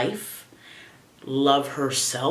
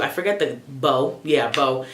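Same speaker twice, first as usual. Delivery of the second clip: ordinary delivery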